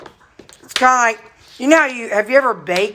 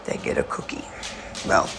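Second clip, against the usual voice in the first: whispered aside; 9 dB quieter, faster than usual. Real, not acted.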